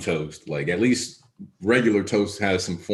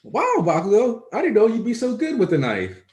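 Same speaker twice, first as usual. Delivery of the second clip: high-pitched admiring character voice; Ochako; 13 semitones higher than usual. Retold or imitated. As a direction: pitch it way up, amazed at his skill. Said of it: "Ochako's like".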